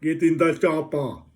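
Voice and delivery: slow, loud, lightly Austrian action-hero line; a rough Schwarzenegger bark; Arnold impression. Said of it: "phrases go like Arnold".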